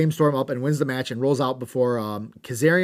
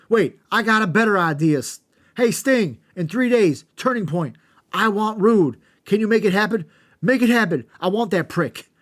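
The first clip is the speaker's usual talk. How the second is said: higher, louder shouting trash talk; hammed-up wrestling promo; 8 semitones higher, 6 dB louder than usual. Host acting out wrestler's mic rant, loud mock shouting. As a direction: yell it into the mic at your rival. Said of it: "and A J says".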